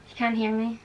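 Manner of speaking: normal talking voice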